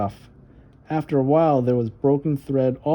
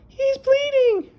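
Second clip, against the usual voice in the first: put-on high, slow alarmed child's cry; a little girl shouting for help; calling out in alarm; 4 dB louder, slower than usual. Little sister calling the babysitter about the blood, alarmed high cry. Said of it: "Violet called to the babysitter".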